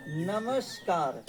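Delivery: drawn-out नमस्कार, each syllable stretched slowly; slow spelling demo. Shown as a demonstration. Teacher to students, slowly dictating the word. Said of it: "देखिए नमस्कार ऐसे".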